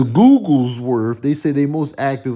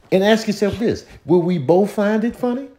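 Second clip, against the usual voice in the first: ordinary talk, nothing marked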